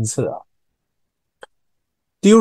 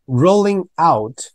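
"rolling out" higher and slow; emphasized demo; 6 semitones higher, 3 dB louder, slower than usual. Shown as a demonstration. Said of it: "讲一下". (拉高放慢 rolling out)

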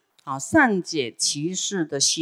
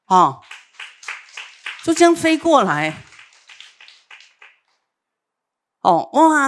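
amazed crowd; 6 semitones higher, 6 dB louder than usual. A narrator's reading. Crowd amazed at flying elephant.

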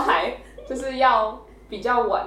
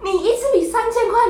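high, fast, laughing mock scolding; shrieking disbelief at the price, played for laughs; mock outraged mom; 13 semitones higher, 6 dB louder than usual. Imitating mom scolding her child over a pricey pencil, high and laughing.

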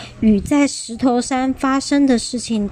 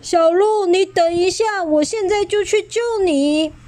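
高声呼喊; 8 semitones higher than usual. Acted. 霸王龙冲下山喊小鹿.

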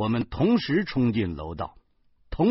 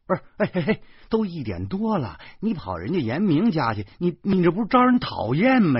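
high, raised, flustered objection; exasperated husband, voice pitched up; agitated protest; 8 semitones higher, 5 dB louder than usual. A narrator's reading. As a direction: interrupt and object urgently. Husband protesting her late visit.

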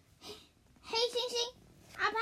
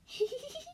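gloating giggle; 9 semitones higher, 5 dB quieter, faster than usual. Acted. Apan gloats over hippo.

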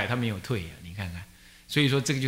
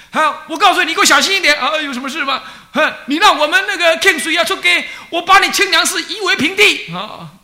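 hammed-up threatening shout, much higher and louder, laughing through it; mock threat; 10 dB louder than usual. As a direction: play the furious brother threatening to flatten the temple, with a laugh. Brother threatening over phone.

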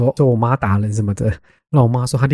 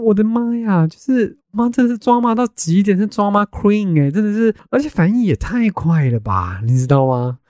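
amazed disbelief; 8 semitones higher than usual. Retold or imitated. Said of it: "我想说".

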